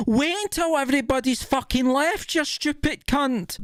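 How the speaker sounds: high-pitched, ranting exasperation; exasperated telling-off. Acted. Ranter to pupil handing homework in early, exasperated.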